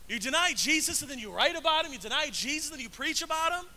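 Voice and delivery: half-yelled rebuke; mock yelled reproach. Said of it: "I wanna yell at him".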